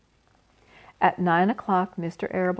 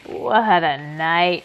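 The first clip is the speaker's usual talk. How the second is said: slow, loud hoarse rat voice; 6 dB louder, much slower than usual. A narrator's reading. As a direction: croak it out, drawn out. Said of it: "he replied hoarsely".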